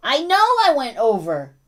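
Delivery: loud, high-pitched pet talk; answering the dog. Real, not acted. Owner admits to yelling dog stream ran over, pet talk.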